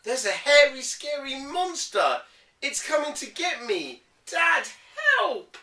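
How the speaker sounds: high, loud pretend fright; hammed-up scared yell; mock panic. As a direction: cry wolf, frightened child. Kipper fakes monster alarm.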